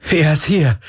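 high, elated exclamation; jubilant. A narrator's reading. Galen tells Kirai the good news, jubilant. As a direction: cry it out in triumph. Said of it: "jubelte Galen".

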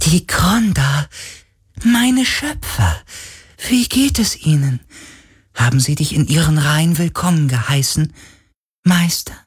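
hopeful otherworldly creature; sea spirit, hopeful. A narrator's reading. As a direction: ask after the makers with high wonder.

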